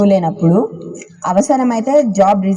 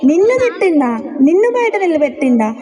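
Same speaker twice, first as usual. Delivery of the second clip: outraged father voice; 7 semitones higher than usual. Acted.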